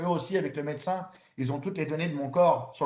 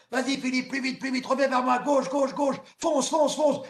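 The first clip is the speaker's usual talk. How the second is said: shouted coaching; 8 semitones higher than usual. Retold or imitated. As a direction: bark him toward the shore. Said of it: "Jacques Tuzet".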